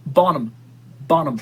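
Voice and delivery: Bonham said as BON-um, H dropped; silent H. Shown as a demonstration. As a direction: correct them with Bonham, no H sound. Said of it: "Stop pronouncing the H in her name".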